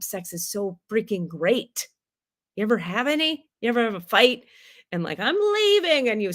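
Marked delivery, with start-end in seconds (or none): 5.2-6.1 high, huffy I'm leaving; a light hammed-up storm-off; mock huffy exit. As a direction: flounce out of the room. Acted storming out.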